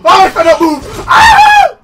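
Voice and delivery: loud laughing shout breaking into a scream; laughing yell. Real, not acted. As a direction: yell at the monster, then shriek. Monster lunges at player.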